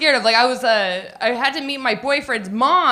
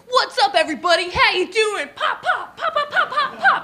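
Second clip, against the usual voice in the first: put-on laughing, much higher hype-girl shout-out; t-shirt gun hype; 8 semitones higher than usual. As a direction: fire off the pops like a giddy cannon. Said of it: "I came out and I was like".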